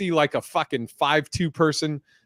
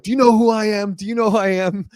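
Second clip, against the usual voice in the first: loud, high, entitled demand; self-important outrage; 8 semitones higher, 6 dB louder than usual. Retold or imitated. Rude passenger pulling rank on airline lady, self-important outrage. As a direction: imitate an indignant big shot, voice pitched way up. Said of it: "some guy was being an asshole".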